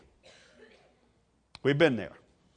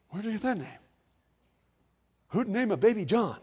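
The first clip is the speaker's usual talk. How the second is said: high, puzzled mock objection; incredulous relatives; 6 semitones higher, 3 dB quieter, faster than usual. Relatives object to the baby's name, higher and incredulous. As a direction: question it in hushed, higher-pitched disbelief.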